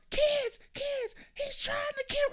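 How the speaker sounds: shrieked cry for help, extremely high; imitated screaming. Man imitating his girlfriend calling the kids, screaming. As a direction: mimic her screaming in panic for the kids. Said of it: "she's screaming at the kid".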